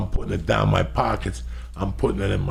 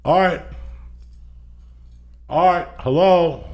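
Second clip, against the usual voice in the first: high, drawn-out call for someone; 9 semitones higher, 3 dB louder, much slower than usual. Retold or imitated. Intruder calling for Art in an office, tentative high call. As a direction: call into empty rooms in a raised voice.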